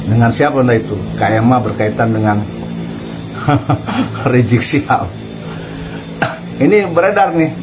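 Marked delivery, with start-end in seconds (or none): 2.4-4.3 mocking laugh. Real, not acted. Mocking the alleged recording.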